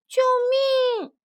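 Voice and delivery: drawn-out, acted cry for help; storybook cry of fear; frightened little mole. A narrator's reading. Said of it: "小鼹鼠害怕极了".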